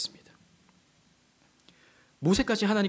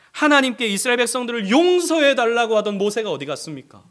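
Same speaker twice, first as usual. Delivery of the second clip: high, loud rhetorical preaching; fervent pulpit delivery; full-force preaching; 7 semitones higher, 8 dB louder than usual. Acted. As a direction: drive the point home to the congregation.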